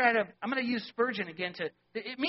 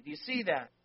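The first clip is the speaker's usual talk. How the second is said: ordinary talk, nothing marked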